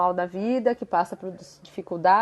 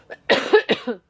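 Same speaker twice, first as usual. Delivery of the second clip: short real cough; a sudden dry cough; 3 semitones higher, 6 dB louder than usual. Real, not acted. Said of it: "desculpa, a tosse".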